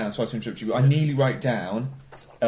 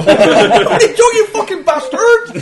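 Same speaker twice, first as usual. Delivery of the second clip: much higher, louder, slow laughing West Country voice; mock Bristol accent; 12 dB louder, slower than usual. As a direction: ham up a Bristol yokel while laughing. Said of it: "why it's from Bristol".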